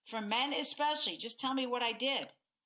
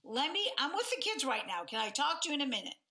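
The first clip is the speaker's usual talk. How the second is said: high, rushed and flustered; hurried, harried high voice; frazzled brush-off; 5 semitones higher than usual. Retold or imitated. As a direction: fend him off while juggling the kids. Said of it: "Usually our tone is".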